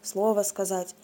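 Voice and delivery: ordinary delivery, nothing marked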